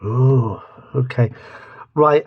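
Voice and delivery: normal talking voice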